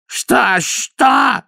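high squeal of alarm; shrieking. A narrator's reading. Downey shrieking at Vimes after the punch. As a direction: shriek it in shock. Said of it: "завизжал Дауни".

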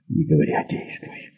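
hushed, voiceless urging; curt command under the breath; whispered order. A narrator's reading. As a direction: whisper it to push a waverer on. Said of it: "прошептала Алиса".

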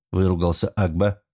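plain reading voice

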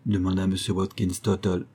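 ordinary delivery, nothing marked

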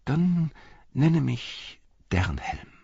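high, slow, whispery reveal; saying it softly. A narrator's reading. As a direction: let the name out slowly in a whisper. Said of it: "sagte der Reiter leise".

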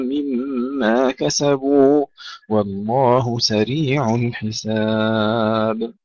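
slow, measured tajweed recitation with long held vowels; Quran recitation. Acted. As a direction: recite slowly, drawing out the elongations and nasal sounds. Recites verses before teaching them. (تلاوة مرتلة بطيئة بأحكام التجويد)

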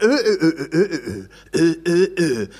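rowdy, grumbling Ö sounds with a laugh; mock the grumblers with Ö; mock rowdy Ö grunts. Shown as a demonstration. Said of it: "die pöbeln sehr oft".

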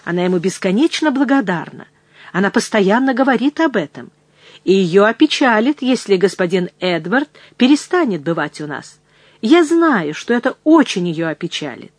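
ordinary delivery

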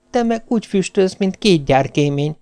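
high, feeble wife voice; ailing wife retorting. A narrator's reading. Dying wife retorts in put-on voice. (magas, erőtlen feleséghang)

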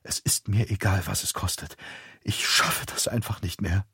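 a voice about to break; near tears. A narrator's reading. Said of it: "als wäre sie den Tränen nah". (leise und den Tränen nah)

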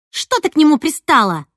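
high, loud attack; laying into her. A narrator's reading. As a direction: go at the rival hard. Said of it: "напустилась на меня Снежана". (напустилась, громко)

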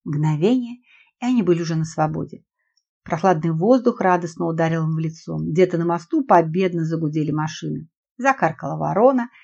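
ordinary delivery

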